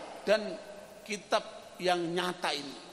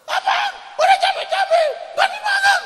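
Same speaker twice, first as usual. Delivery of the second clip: shrill, much higher furious shout; angry wife; 12 dB louder than usual. Retold or imitated. Wife yelling at husband who won't eat her cooking, furious high shout. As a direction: scream it like a wife whose cooking was wasted.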